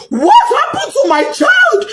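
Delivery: high, slow desperate question; a grieving mother demanding answers; anguished cry. Real, not acted. Mother crying out to the school.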